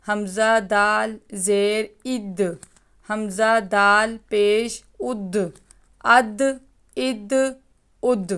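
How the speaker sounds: اد with the دال bounced and echoed at the end; teacher sounding اد with a clear qalqala bounce; qalqala demo. Shown as a demonstration. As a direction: drill اد slowly, letting the دال rebound. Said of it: "یوں پڑھیے گا".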